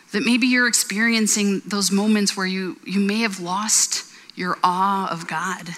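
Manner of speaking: usual speaking voice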